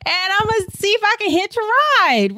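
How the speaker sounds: high, loud and bright with the plan; eager high voice announcing the hitchhike plan; excited self-quote. Retold or imitated. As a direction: pitch it up like a daring idea just struck you. Quoting her own bold plan.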